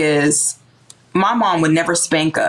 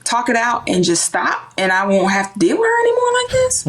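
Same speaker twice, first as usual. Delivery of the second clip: put-on inner kid voice, raised; 7 semitones higher than usual. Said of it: "I thought".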